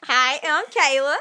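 playful put-on Southern twang; Southern country voice. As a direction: introduce yourself in a mock country accent. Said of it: "a southern voice".

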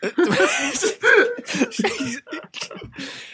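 very high squealing laugh; real high-pitched cackle; shrieking laugh. Real, not acted.

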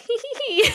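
high sneaky tee-hee giggle; mock giggle. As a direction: giggle like a sly escapee. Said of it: "was like".

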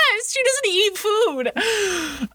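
laughing through the words, drawn out slowly. Real, not acted. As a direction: giggle it out slowly, very high. Laughing after friend's joke.

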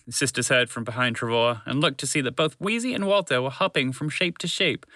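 plain reading voice

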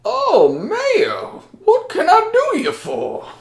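high, put-on folksy greeting; cheery high shopkeeper voice; townsfolk character voice. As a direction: pitch way up into a friendly local's voice. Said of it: "And now I get to roleplay with myself".

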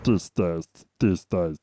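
deep imitation voice. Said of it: "How do you do that?".

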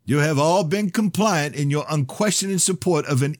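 high, loud fervent preaching. Acted. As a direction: preach it like a fire sermon. Broadcast sermon.

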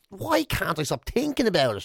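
normal talking voice

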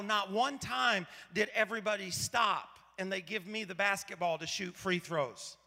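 normal talking voice

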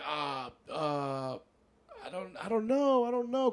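nervous stammer. Retold or imitated.

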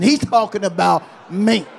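with an attitude. Shown as a demonstration. Preacher asks church to say it with an attitude. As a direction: give it some attitude. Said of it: "Say it with an attitude".

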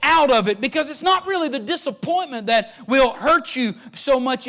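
loud, high preaching build that slows at the end; full-force preaching. Acted. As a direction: preach it out to the whole church.

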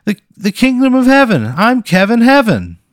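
high, loud, nervous local ad voice; nervous TV pitchman. Acted. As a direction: play an awkward local TV salesman. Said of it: "He goes".

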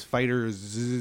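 "Fighter Z" with a long buzzing zzz at the end; slow down and hold the Z of "Fighter Z" as a buzz; accentuated Z. Shown as a demonstration. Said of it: "accentuate the Z".

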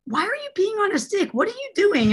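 very high-pitched mock disbelief; incredulous questioning. Husband baffled by wife's pregnancy test, high incredulous questioning. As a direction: imitate someone baffled, pitch way up.

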